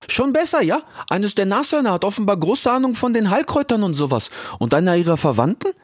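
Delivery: high, cheeky youth voice; Ilias. Acted. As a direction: call out playfully, very high. (frecher Ilias, hoch und laut gerufen)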